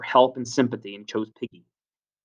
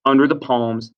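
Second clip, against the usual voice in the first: usual speaking voice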